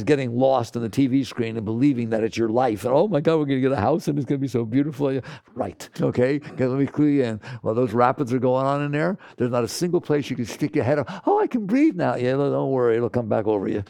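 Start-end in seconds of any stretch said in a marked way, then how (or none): 2.9-5.1 fast, breathless mock excitement; giddy daydreaming mind, rushed; mock daydream gush. Mocking the mind's daydream. 11.3-12.2 high, loud mock gasp of relief; hammed-up relief, much higher. Mocking relief in the rapids.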